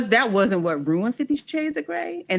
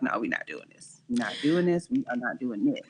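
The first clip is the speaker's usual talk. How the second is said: firm refusal to self; 8 semitones lower, 7 dB quieter, faster than usual. Retold or imitated. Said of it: "No, I was like".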